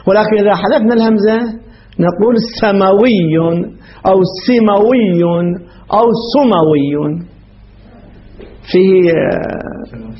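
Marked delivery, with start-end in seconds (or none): 2.6-3.6 سموي said slowly "samawiyyun", a after the س; fatha variant. Shown as a demonstration. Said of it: "ثلاث لغات". 4.5-5.2 سموي said slowly "simawiyyun", i after the س; kasra variant. Shown as a demonstration. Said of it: "ثلاث لغات". 6.3-7.2 سموي said slowly "sumawiyyun", u after the س; damma variant. Shown as a demonstration. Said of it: "ثلاث لغات".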